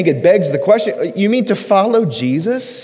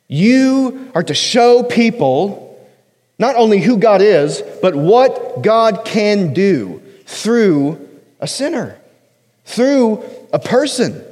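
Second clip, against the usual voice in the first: slow emphatic; slower than usual. Shown as a demonstration. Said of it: "preaching point".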